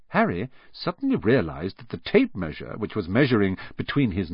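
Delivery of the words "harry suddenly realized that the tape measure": plain reading voice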